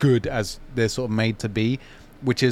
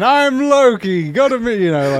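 Loki, dark lord; 9 semitones higher, 5 dB louder than usual. Retold or imitated. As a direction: proclaim it like a pompous god-king. Loki's grand spiel retold.

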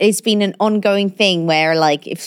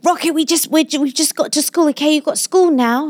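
coaxing parent; 8 semitones higher than usual. Retold or imitated. Parent pleading with toddler, retold.

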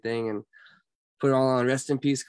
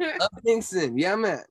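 higher, swooping signature shout-out; imitated Carlos Culture; 7 semitones higher than usual. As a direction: call out his catchphrases, pitched up. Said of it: "he would always be like".

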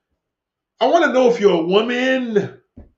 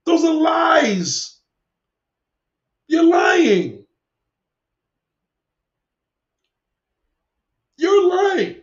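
higher, raised voice, slow and heated; angry accusation; 7 semitones higher, much slower than usual. Real, not acted. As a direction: call her out as a liar. Accusing a viewer of lying.

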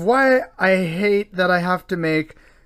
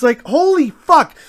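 higher and louder, fed up; exasperated outburst; 6 semitones higher, 5 dB louder than usual. Real, not acted. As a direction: blurt it in exasperation. Exasperated rant peak.

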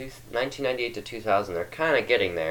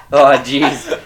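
loud laugh through the words; 3 semitones higher, 11 dB louder than usual. Real, not acted. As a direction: laugh out the complaint. Laughing at long recording.

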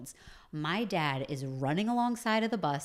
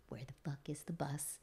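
whispered angry dad; 12 dB quieter than usual. Retold or imitated. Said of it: "he's like".

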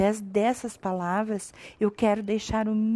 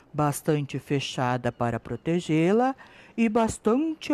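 lightly lower, gentle mother's voice; smiling mother; 6 semitones lower, 6 dB quieter than usual. Acted. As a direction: guide her kindly, smiling. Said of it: "sempre a sorrir".

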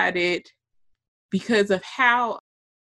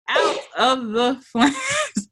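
slow, laughing cry of out of the flames; laughing shout; 4 semitones higher, slower than usual. Real, not acted. Laughing to her cohost about surviving, slowed. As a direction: drag it out through a laugh.

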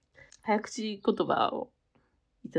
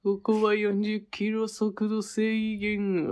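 very slow, drawn-out cartoon voice; Anago-san impression; much slower than usual. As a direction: read the sign like Anago. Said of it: "アナゴさんで。".

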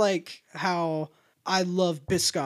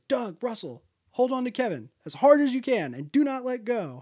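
urgent command; 7 semitones higher, 4 dB louder than usual. Retold or imitated. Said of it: "he goes".

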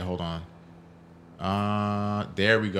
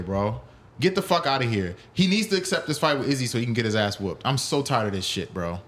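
ordinary delivery

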